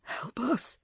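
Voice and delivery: hoarse croak. A narrator's reading. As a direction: croak it out, wounded. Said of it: "croaked Neve".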